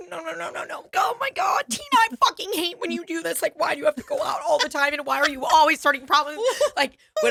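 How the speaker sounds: high, whiny mock exasperation; exasperated coworker. Coworker scolding Tina for starting problems, high whiny. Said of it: "Hannah's like".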